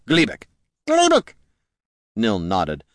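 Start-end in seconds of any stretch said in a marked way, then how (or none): 0.1-0.5 lightly voiced, raised-pitch introduction; introducing a squire. Gorm introduces his squire. 0.9-1.3 very high, louder squeal; squeaky creature squire. Acted. Squire chirps its own name.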